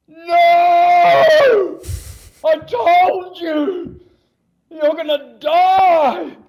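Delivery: hammed-up panicked shout at a reckless friend; furious shout. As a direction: scream it like a friend who thinks you'll die. Friend squeezes him, screaming.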